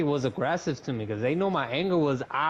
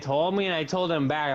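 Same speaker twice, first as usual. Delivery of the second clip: ordinary talk, nothing marked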